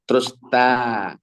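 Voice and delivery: ordinary talk, nothing marked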